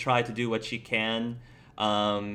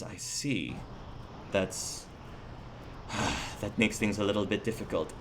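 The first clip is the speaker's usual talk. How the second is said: low, quiet acted disappointment; understated, thoughtful letdown; subdued; 4 semitones lower, 7 dB quieter than usual. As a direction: take the bad news softly, with a sigh. Knight takes in news of deaths.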